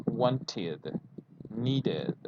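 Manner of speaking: wanted and needed slowly, with a clear id ending; slow model of wanted. Shown as a demonstration. Teacher slowly models wanted and needed for the class. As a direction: stretch out the extra id syllable. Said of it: "then you say".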